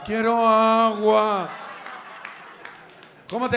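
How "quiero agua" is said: slow, drawn-out ghost voice; lost soul begging slowly for water; ghostly moan. Pretend. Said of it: "Va el alma".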